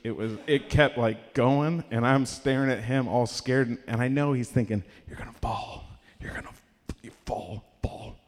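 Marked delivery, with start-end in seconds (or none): 5.1-8.2 hushed, sinister-style mind trick whisper; whispered thoughts. Retold or imitated. Cop silently willing him to fall, whispered.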